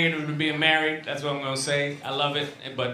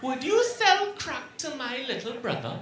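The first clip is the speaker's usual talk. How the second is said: imitating wife; 10 semitones higher, 5 dB quieter than usual. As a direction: play the wife asking a trap question. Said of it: "she's like".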